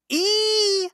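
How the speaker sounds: very high sustained mock "E" squeak; imitating her E. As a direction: hold a thin high E like her. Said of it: "I love the E's".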